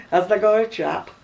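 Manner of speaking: "Where's tha been?" in broad Oldham dialect, tha short and unstressed. Shown as a demonstration. Showing her friend the old Oldham way of speaking, broad Lancashire. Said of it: "Have you got a fella?".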